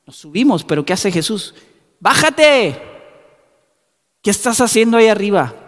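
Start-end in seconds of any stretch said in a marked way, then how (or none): 2.0-5.5 calling out. Acted. Jesus voiced calling Zacchaeus.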